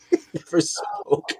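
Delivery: high, slow, breathy eerie hush; spooky whisper. Acted. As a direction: whisper it like a ghost story. Dramatic retelling of the creepy show.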